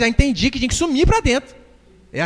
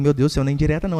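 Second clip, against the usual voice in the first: ordinary talk, nothing marked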